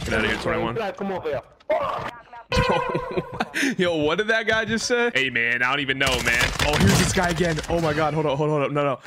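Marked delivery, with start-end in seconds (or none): 2.3-3.7 high, helpless cackle; burst of high laughter. Real, not acted. Streamer bursting out laughing at an enemy's voice. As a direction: crack up at the voice.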